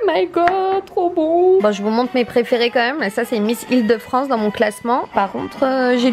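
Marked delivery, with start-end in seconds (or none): 0.1-1.6 high, delighted squeal. Real, not acted. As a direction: squeal with delight over the taste. Tasting hot chocolate, delighted.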